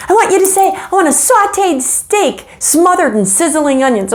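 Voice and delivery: high, sneering schoolgirl taunt; mean bully voice. Retold or imitated. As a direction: taunt like a mean older girl. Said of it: "and she said".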